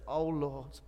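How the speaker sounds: slow, soft, broken confession; humbled plea. Retold or imitated. Peter kneeling before Jesus, slow humbled plea. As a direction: plead quietly on your knees. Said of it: "fell to his knees".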